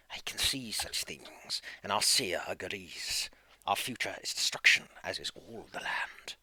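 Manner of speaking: slow ominous whisper. Acted. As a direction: hush it out like a prophecy. Seer's ominous whispered prophecy.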